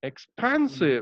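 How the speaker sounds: "expansive" slowly, with a drawn-out PAN; stress on PAN. Shown as a demonstration. Teacher modelling syllable stress, "expansive" slow, stressed on PAN. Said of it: "how to stress upon this syllable".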